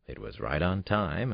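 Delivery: ordinary delivery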